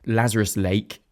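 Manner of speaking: ordinary delivery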